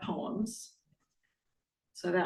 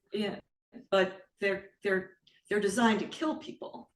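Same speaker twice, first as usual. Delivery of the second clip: ordinary talk, nothing marked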